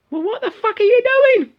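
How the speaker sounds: put-on high, loud falsetto child; mock kid yelling at mum; hammed-up squeaky outraged lad; sweary little boy. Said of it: "little Tommy saying to his mum".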